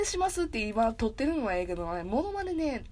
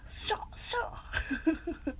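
whispered high catchphrase attempt; attempted Morikubo; 5 semitones higher, 5 dB quieter than usual. Streamer testing a catchphrase, whispered mock impression. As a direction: hiss out two quick failed shouts. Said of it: "森久保さんの声は出ねえな".

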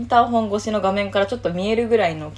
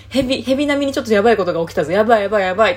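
fast and louder, a mild mock panic; excited alarm; 6 dB louder, much faster than usual. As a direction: hurry to tell the family big news. Telling family something happened.

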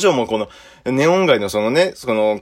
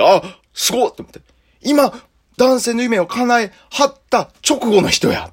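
much higher, louder excited gasp; thrilled realization; 12 semitones higher, 3 dB louder than usual. Retold or imitated. A courier thrilled to see a woman leaving the club. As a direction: marvel out loud in awe.

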